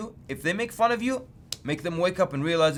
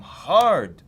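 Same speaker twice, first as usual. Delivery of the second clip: "hard" drawn out long and loud; stress on hard; 3 semitones higher, 9 dB louder than usual. Real, not acted. Preacher telling how they hit him with warnings, stressing "hard". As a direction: stretch "hard" out with heavy force.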